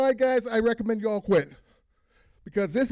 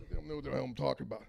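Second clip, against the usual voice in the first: ordinary delivery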